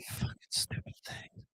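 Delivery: a real under-the-breath whispered swear; whispered aside cursing the gear; whispered curse. Real, not acted. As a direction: hiss it to yourself in frustration. Muttered curse at broken mic.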